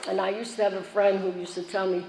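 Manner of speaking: normal talking voice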